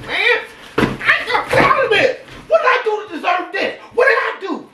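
high-pitched wailing protest; pleading high-pitched yell; overwhelmed outcry. Real, not acted. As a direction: cry out high, overcome by it all. Overwhelmed outcry over gifts.

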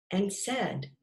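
ordinary delivery, nothing marked

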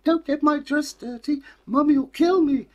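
put-on high, loud childish fretting; worried child reminding herself; little girl. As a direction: falsetto little girl, anxious. Girl fretting about dress.

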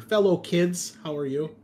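ordinary delivery

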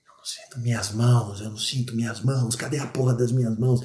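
a hushed panic, repeating in disbelief; injured soldier losing his nerve; wounded man panicking. Acted. Voices wounded comrade panicking.

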